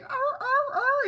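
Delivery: very high, loud imitated husky wails; female husky mating cry. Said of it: "she's like".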